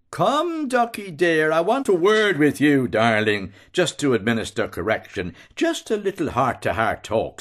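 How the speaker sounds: high, honeyed coaxing with a menacing edge. A narrator's reading. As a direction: sweet-talk the victim before the punishment. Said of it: "Bello, coaxingly".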